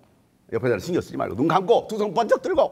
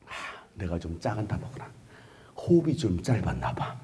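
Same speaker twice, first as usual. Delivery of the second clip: much softer, half-whispered musing; muttering to himself; 7 semitones lower, 8 dB quieter than usual. Acted. Bartimaeus thinking he was too quiet, murmuring softly. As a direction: mutter it under your breath. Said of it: "하면서".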